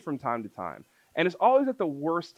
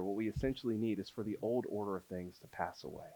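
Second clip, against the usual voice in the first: soft, measured preaching refrain; quiet earnest pulpit close; solemn sermon appeal; 3 semitones lower, 4 dB quieter than usual. Acted. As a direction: lay out each need gravely, then land the refrain.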